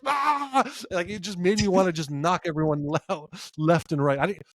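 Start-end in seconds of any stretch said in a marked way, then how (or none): 0.1-0.6 high sharp mock yell "Ah!"; mock war cry. A fan recalling the cage jump, with a high mock yell. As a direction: let out a quick high battle shout. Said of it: "I'm like".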